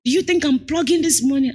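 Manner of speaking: a hint of a client yelling insults; mock yelling client. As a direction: imitate a rude client lightly. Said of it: "one that even yelled at me".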